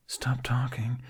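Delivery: hissed whisper to himself. A narrator's reading. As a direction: whisper it to yourself. Jack scolds himself.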